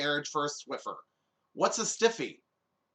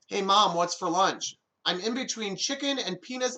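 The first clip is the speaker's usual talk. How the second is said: ordinary talk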